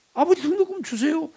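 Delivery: high, pert demand to dad; child asking father. Retold or imitated. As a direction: pipe up as a child asking for money. Plays child asking father for tuition.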